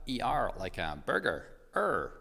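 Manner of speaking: imitated American mom with strong R's; American "burger", R fully sounded; American accent. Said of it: "who has a proper American accent".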